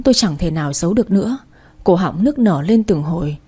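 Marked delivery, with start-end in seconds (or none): none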